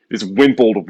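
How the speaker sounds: normal talking voice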